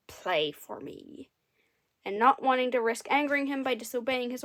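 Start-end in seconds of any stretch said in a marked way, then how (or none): 0.1-1.3 low, slow, soft growled order; deep grumbling demand; impatient snarl. A narrator's reading. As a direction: snarl it like a sulky giant. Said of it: "snarled in an impatient tone".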